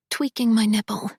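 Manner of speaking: usual speaking voice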